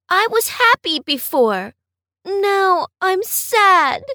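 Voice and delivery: gently voiced sad little boy; a touch of crying. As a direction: Jack telling the principal he is sad, lightly tearful. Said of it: "cried brave Jack".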